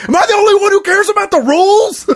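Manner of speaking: high, laughing hammed-up shout; imitated Walter losing it; mock outraged yell. Walter waving gun.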